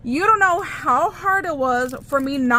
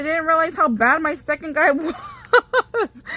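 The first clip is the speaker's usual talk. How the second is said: higher voice breaking into a laugh; 4 semitones higher, slower than usual. Real, not acted. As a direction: say it through amused disbelief, cracking up. Laughs at her botched purchase.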